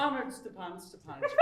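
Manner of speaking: usual speaking voice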